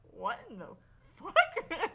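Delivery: incredulous, rising to a loud burst; stunned disbelief, swearing; shocked outburst. Retold or imitated. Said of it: "I'm like".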